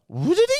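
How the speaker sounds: sung chime sound. Retold or imitated. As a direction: sing it like a device powering up. Imitating iPad startup chime.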